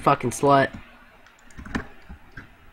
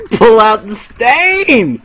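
yelling; 8 semitones higher, 6 dB louder, much slower than usual. Real, not acted. Yelling during game chaos.

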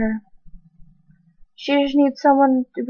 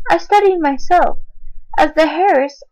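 usual speaking voice

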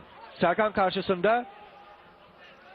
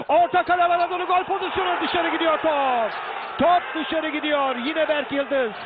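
screaming football commentator; 6 semitones higher than usual. Real, not acted. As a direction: yell the miss as the ball goes wide. Goal chance, ball goes wide.

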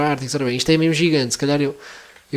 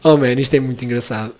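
ordinary talk

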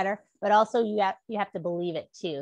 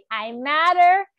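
loud, high proud declaration; with gusto; 7 semitones higher, 10 dB louder, slower than usual. Shown as a demonstration. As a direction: shout it with conviction. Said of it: "Say it with gusto".

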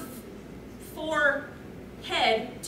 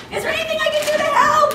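fast, shrill-pitched panic, much higher and louder; frantic, high and loud; 11 semitones higher, 6 dB louder, much faster than usual. Acted. Friend asking a dizzy girl if she needs help, frantic and high. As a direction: blurt it out in a frantic rush. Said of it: "I asked frantically".

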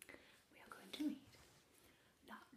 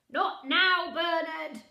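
loud, higher brush-off; dismissive father; 8 semitones higher, 22 dB louder, much slower than usual. Acted. Father brushing off Bernard, dismissive. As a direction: wave the child away, busy.

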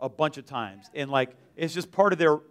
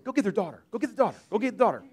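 higher-pitched, insistent repeated order; urgent instruction; 4 semitones higher, faster than usual. Retold or imitated. Speaker urging helpers to fetch the child, urgent. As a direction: press them to go, without shouting.

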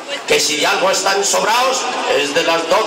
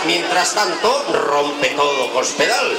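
ordinary delivery

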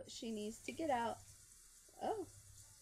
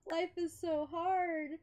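drawn-out, louder mock wail; mock crying; 6 semitones higher, 3 dB louder than usual. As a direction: put on a slow, higher-pitched pity-me sob. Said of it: "she's crying. Oh, poor girl. She's like".

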